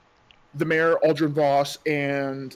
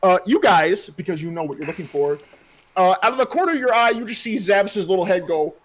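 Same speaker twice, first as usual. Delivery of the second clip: normal talking voice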